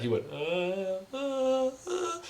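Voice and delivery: mock sung rising notes. Imitating the singer's rising notes.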